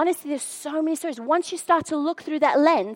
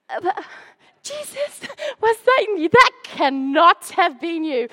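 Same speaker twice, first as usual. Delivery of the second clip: startled disbelief; 4 semitones higher, slower than usual. Retold or imitated. Woman answering God's nudge in shocked disbelief. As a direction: caught off guard, questioning God. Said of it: "I was like".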